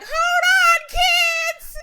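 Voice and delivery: high, loud panicked mom shout; mom bracing the kids. Retold or imitated. Mom yelling at her kids to hold on in a swerving car, high panicked shout. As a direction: yell it like a mom gripping the seat as the car swerves. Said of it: "Mom's like".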